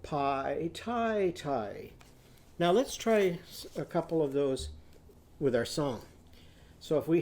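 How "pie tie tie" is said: "Pie, tie, tie" with a long eye sound; long i vowel. Shown as a demonstration. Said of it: "what does it say now".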